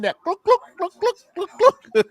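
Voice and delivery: high glugging oil-can noise; playful imitated glug glug sound. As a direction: mimic an oil can pouring. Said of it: "that three-in-one oil".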